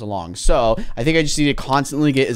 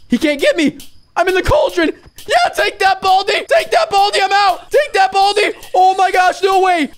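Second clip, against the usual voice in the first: gloating shout. Real, not acted. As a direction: crow at the monster in triumph. Taunting Baldi from hiding.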